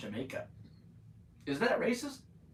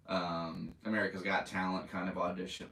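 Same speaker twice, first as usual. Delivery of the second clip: usual speaking voice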